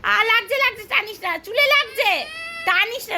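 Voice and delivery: high whimpering ouch, playfully exaggerated; mock crying to make her stop pulling; mother fake-crying in a high voice at her daughter pulling her hair; pretend pain cry.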